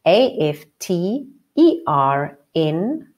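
slow, sing-song letter naming; slow spelling. Shown as a demonstration. Teacher spells the word for the class, slow and sing-song. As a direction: spell out the letters of afternoon slowly, one by one. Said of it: "How do we spell afternoon?".

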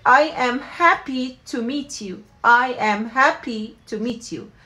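slow repeated phrase; slow teaching model. Shown as a demonstration. Teacher repeating the phrase for learners to copy. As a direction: drill it twice, slowly. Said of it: "So بنحكي".

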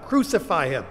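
loud accusing cry; acted mob demand at full voice; crowd's cry. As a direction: hurl the sentence as an outraged crowd. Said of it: "who cried these words".

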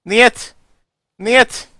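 yelling niet. Acted. Soldier yelling niet at the enemy who wants to be friends, defiant. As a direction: shout it back, defiant. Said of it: "I just kept in yelling, "Niet, niet, suka".

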